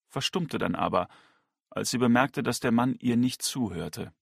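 plain reading voice

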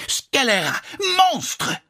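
high-pitched French shriek of abuse; shrieked insult. A narrator's reading. Slavic lady hurls insults at Parker Pyne, shrieking. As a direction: screech each French insult separately. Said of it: "kreischte die slawische Dame".

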